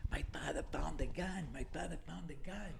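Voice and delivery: hushed, whispery cousin voice; imitated whispered warning. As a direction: pass it on as a secret, under the breath. Cousin secretly warns him in story.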